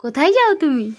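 put-on bright, loud sing-song quizzing of a toddler; loud child-directed teaching. Mother quizzing her toddler on letters, in a loud sing-song. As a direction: coax the little one through letters and numbers, loud and eager.

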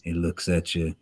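low, soft shark-hunter monologue from Jaws; Quint. Acted. Showing clients his dragon's voice, low shark-hunter impression. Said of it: "he kinda talks like this".

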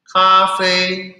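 Kafe chanted high and level; "Kafe" with both syllables in a sustained high tone; first tone. Shown as a demonstration. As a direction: hold both syllables of "Kafe" long on one high pitch. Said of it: "Semua nada satu".

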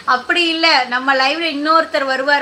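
ordinary talk, nothing marked

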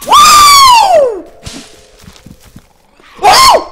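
very high, loud screamed whoop; long shrieked "woo" of alarm; panicked whoop. Real, not acted. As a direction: falsetto scream. Attacked in game.

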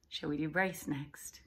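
usual speaking voice

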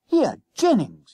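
high, loud, indignant schoolboy; indignant cry. A narrator's reading. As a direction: call out in affronted protest. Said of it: "he cried indignantly".

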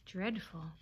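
softly spoken, falling at the end. A narrator's reading. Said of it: "said Bambi softly".

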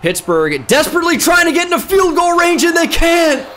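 hyped-up football announcer; play-by-play announcer. Acted. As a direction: call it like a TV play-by-play man. Mock play-by-play call.

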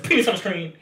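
sudden high call-out; announcing loudly. Shown as a demonstration. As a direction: call it out fast. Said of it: "Okay, ready, set".

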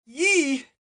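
high-pitched "yee" squeal; short cartoonish yelp. A narrator's reading. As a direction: squeal while leaping clear. Dwarf leaps clear of the blast.

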